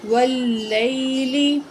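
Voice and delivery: every sound stretched very slowly; slow blended reading. Shown as a demonstration. Teacher joining syllables into a word for the pupil, very slow. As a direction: draw the joined word out carefully.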